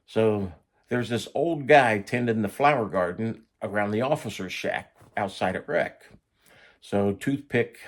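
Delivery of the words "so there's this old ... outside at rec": ordinary delivery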